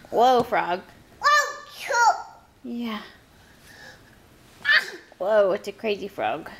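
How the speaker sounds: excited. Real, not acted. Excited at frog toy.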